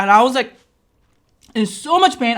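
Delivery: ordinary talk, nothing marked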